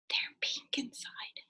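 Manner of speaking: whisper. Acted. Whispered answer to children.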